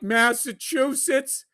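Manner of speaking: mock-naive, stretched-out reply; meek answer. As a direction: answer the yelling cabbie haltingly. Sheepish answer to yelling cabbie.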